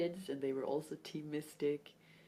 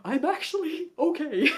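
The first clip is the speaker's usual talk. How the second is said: high, loud, laughing "I'm fine"; laughing reassurance; 11 semitones higher, 16 dB louder than usual. Retold or imitated. Answering worried mom, laughing loudly. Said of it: "And I'm like".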